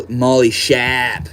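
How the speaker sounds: drawn-out Maine accent, no R in Shap; slow Maine-accent demo; Mainer accent. Shown as a demonstration. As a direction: say it slowly like a Mainer, "sharp" as "Shap". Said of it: "Saying sharp in a Mainer accent".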